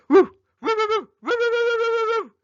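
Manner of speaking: high, loud yipping fox barks; fox barking. A narrator's reading. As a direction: bark like a fox in the night. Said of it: "barked ferociously, as a fox might".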